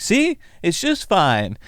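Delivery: high and slow, smug. Acted. Darwin smugly telling his friend it's fine, slow high voice. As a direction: drag it out, proving a point.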